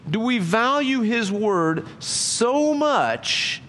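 high, drawn-out searching question; slow emphatic preaching. Real, not acted. Preacher pressing the congregation, slow and earnest. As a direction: stretch every word to make them think. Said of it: "preacher pleading slowly".

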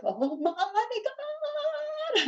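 high, drawn-out panicked wail; freaking out. Retold or imitated. Mother retelling her son's seizure, panicked wail. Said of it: "I'm, like, freaking out".

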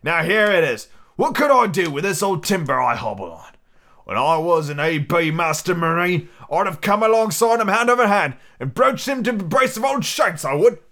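lively, rolling seafarer's voice; old sea dog. Acted. Long John Silver reminiscing about his sailing days, as a hearty sea dog. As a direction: play a hearty one-legged old sailor.

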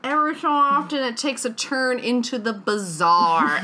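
usual speaking voice

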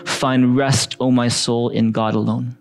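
slow, deliberate reading of the verse; meditative slow read-aloud; slow scripture reading. Shown as a demonstration. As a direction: let each phrase sink in slowly. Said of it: "We're gonna read it one more time".

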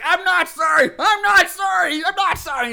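loud, high mock-enraged shouting; tearful anger. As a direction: shout it defiantly, over and over. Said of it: "the crying turns to anger".

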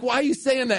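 high panicky question; alarmed high. Retold or imitated. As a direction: voice jumping up in alarm.

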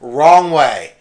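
loud announcement, settling at the end; election master correcting himself. Retold or imitated. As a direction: imitate an official redirecting a queue. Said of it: "He's like".

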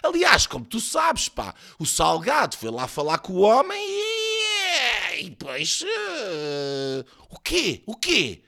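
high voice with drawn-out hesitations; hammed-up, hemming and hawing; rambling gossip. A narrator's reading. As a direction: stall on a long squeaky vowel. Voiced Soares gossips, stalling.

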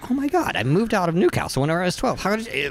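high-pitched, mock-amazed woman; imitated astonishment. Visitor called out at a bonfire, imitated astonishment.

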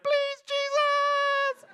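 very high, slow, drawn-out plea; terrified daughter. Retold or imitated. Other daughter begging on the ride, high drawn-out wail. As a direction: wail it like a scared kid on the drop. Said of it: "the other one was like".

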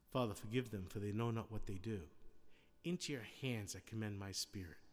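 low, hushed quotation of a prayer; soft, reverent prayer. Retold or imitated. Preacher quoting Jesus praying from the cross, quietly reverent. Jesus's real words, against mock complaint.

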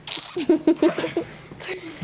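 squealing laugh; high burst of laughter. Real, not acted. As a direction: shriek with laughter. Laughing at hamstring demo.

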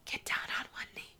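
whisper. Retold or imitated. Family urging proposal.

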